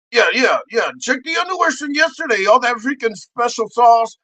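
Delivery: ordinary talk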